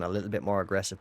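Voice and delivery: ordinary talk, nothing marked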